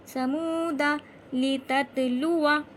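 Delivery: slow chanted syllables, endings unstretched; recitation demo. Shown as a demonstration. Teacher showing which alif stays short, slow recitation. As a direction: recite word by word, slowly. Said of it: "इसको भी नहीं खींचेंगे".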